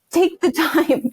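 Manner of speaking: high, slow plea through a laugh. Acted. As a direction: implore them with an exasperated laugh. Acted plea to brands, laughing.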